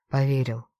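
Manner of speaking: plain reading voice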